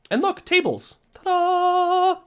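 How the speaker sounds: high, sing-out fanfare; hammed-up high ta-da; playful reveal. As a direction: present it like a magic trick. Showing off the result playfully.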